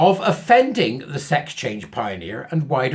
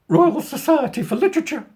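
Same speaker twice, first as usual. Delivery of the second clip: hammed-up upper-class mockery; mock posh; 11 semitones higher than usual. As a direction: announce it like a pompous aristocrat. Mocking posh institution name.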